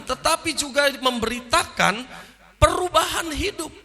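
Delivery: loud, high-pitched sermon climax; forceful preaching. Real, not acted. Preacher urges congregation to change their lives, forceful preaching. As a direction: drive each point home to the congregation.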